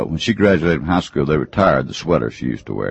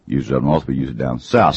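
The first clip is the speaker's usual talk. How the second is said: normal talking voice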